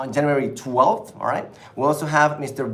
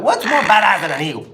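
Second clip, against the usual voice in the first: laughing; 5 semitones higher, 5 dB louder than usual. Real, not acted. Hyped laughing about eagle.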